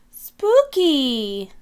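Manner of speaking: high mock-spooky drawl; playful spooky. Peppa showing off her carved pumpkin, in mock spooky tones. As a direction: make it sound playfully creepy. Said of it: "says Peppa".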